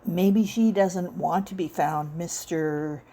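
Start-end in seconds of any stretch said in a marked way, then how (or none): none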